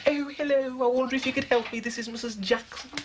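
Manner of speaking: high, affected mimicry of his wife; mock her posh put-on telephone voice, pitched right up. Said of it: "that la-di-da voice you put on".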